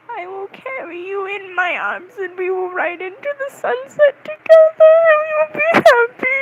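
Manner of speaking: high wailing declaration swelling to loud; hammed-up romantic vow, building to a tearful squeal; melodramatic fantasy vow. Smitten fan imagining riding into the sunset, melodramatic. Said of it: "That's what was going through my mind".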